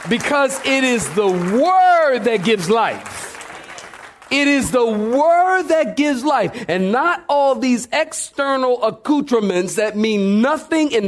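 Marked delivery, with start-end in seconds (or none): 0.1-6.6 full-force preaching. Real, not acted. Preaching climax.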